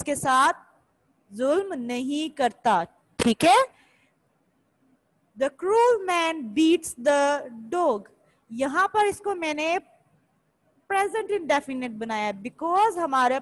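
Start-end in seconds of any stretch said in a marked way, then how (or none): 5.4-8.1 very slow, every word spaced apart; slow dictation. Shown as a demonstration. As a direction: dictate the sentence for copying. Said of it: "First two lines is for the first sentence".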